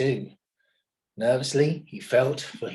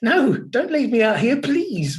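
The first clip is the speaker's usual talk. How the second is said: high, loud pleading wail; a loud, high, frightened wail; wailing plea; 9 semitones higher, 6 dB louder than usual. A narrator's reading. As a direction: plead not to be left outside. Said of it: "wailed McMeer".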